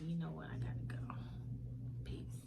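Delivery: soft, whispered sign-off; hushed goodbye. Retold or imitated. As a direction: slip out quietly with a final peace. Quitting the job, retold.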